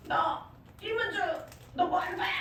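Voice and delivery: imitating a deaconess confronting her before everyone; mock confrontation. Elder deaconess publicly confronting the speaker, mock sharp call-out. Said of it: "난리를 치시면서".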